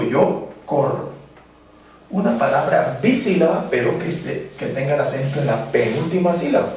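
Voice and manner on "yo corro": slow, even Yo corro, two syllables; teacher showing two-syllable rhythm; rhythmic speaking. Shown as a demonstration. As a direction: say Yo corro slowly to the beat. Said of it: "Entonces yo digo".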